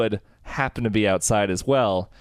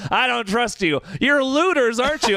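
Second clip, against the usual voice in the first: much higher mock-accusing woman; suspicious neighbor; 11 semitones higher than usual. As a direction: accuse them like a paranoid neighbor. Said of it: "And she's like".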